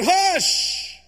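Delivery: high, loud scolding hush; sharp hush. Acted. Crowd hushing the beggar, high and sharp. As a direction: shut him up.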